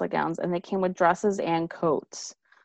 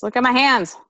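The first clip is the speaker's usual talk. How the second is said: louder, higher repeat; insistent; 6 semitones higher, 6 dB louder, faster than usual. Real, not acted. Showing the listener how the dress gapes, insistent. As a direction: raise pitch and volume to demand attention.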